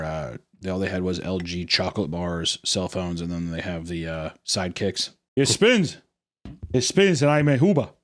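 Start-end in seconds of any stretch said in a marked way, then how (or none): 5.4-7.9 higher-pitched fake Cuban accent; mock Cuban accent. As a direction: show it off in a put-on Cuban voice. Voices a Cuban showing off phone.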